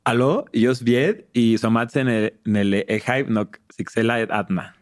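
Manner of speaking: words said in reverse, slowly; backwards speech. Shown as a demonstration. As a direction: reverse each word, syllable by syllable. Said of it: "habla al revés".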